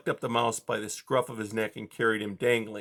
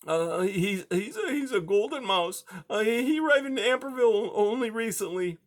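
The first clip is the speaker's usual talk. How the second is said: high, hesitant scared mouse; timid high little voice; frightened mouse; 11 semitones higher than usual. A narrator's reading. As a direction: stammer it out in fear. Mouse answers in fear.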